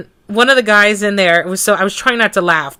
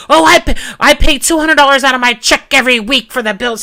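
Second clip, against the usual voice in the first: higher, louder put-on voice of the ex; mock whiny ex; 6 semitones higher, 7 dB louder than usual. Woman mocking her ex's excuse to the judge, high and loud.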